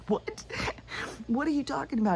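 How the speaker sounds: high, incredulous what; startled cop. Retold or imitated. Cop shocked by the husband's words, high and incredulous. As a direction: leap way up in disbelief. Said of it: "He goes".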